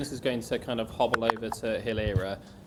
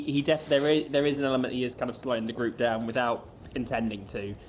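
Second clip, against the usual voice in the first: ordinary talk, nothing marked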